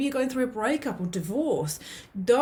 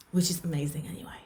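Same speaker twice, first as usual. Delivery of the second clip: quick whispered aside; 4 semitones lower, 3 dB quieter, much faster than usual. Real, not acted. Aside to viewers.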